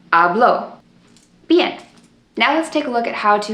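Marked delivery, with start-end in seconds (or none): 0.1-0.8 habló with the O stressed and rising; stress on the final O. Shown as a demonstration. Teacher contrasting the two forms, final O stressed. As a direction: lean on the last syllable of habló. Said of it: "it changes the meaning".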